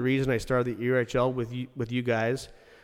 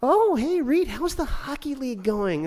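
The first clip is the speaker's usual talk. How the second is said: very high, loud sing-song mock greeting; mocking critics' sudden friendliness, very high; fake-friendly mockery; 13 semitones higher, 4 dB louder than usual. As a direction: mimic them sucking up, shrill and fake. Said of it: "now what they do".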